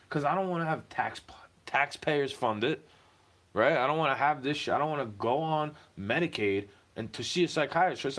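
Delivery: ordinary talk, nothing marked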